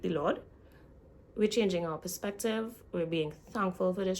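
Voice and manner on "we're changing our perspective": ordinary talk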